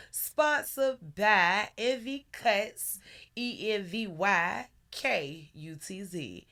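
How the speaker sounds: slow, punchy commercial read. Shown as a demonstration. Host reads the sponsor spot like a commercial. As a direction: sell it like a radio sponsor spot. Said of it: "Oh, I got a good commercial voice".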